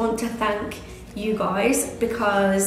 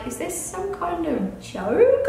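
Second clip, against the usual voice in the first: disbelieving; 7 semitones higher, slower than usual. Retold or imitated. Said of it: "I was just a bit like".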